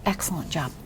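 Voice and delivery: low, soft whispered excellent job; whispered praise. Real, not acted.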